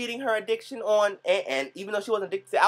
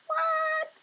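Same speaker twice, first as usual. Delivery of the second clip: very high, startled squeak; shocked what. Retold or imitated. Vlogger hearing Naomi does a cleanse three times a year, high shocked squeak. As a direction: shriek the word in disbelief. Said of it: "I'm like".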